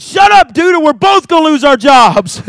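loud, high comic scolding as the lion; hammed-up exasperated yell; talking lion. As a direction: shout down a panicking coworker for a laugh. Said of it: "the lion looked up and said".

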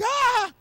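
short shrill-high yelp of fright; mock girlish scream. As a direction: squeal like a startled little girl. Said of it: "And I'm like".

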